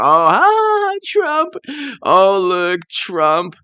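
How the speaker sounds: high, loud sing-song mockery; mocking laughing Democrats. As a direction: ham up their smug delight at Trump. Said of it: "they were laughing so hard".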